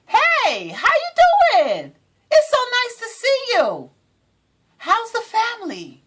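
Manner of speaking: high, slow, sugary fake-nice voice; fake-friendly greeting. Greeting someone who wronged her, fake sweet. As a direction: sweeten it way up, all fake politeness. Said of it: "I was like".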